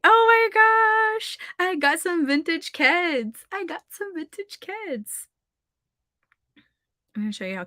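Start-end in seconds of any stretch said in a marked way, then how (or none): 0.1-5.3 high, squealing thrill over a find; excited gush. Retold or imitated. Telling chat about her vintage Keds, high excited squeal. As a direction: gush like you just scored a treasure. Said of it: "I'm pretty excited about this".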